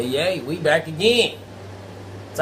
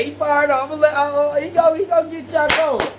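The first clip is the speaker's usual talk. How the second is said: hype-man build-up; 8 semitones higher than usual. Real, not acted. Hyping up the guest.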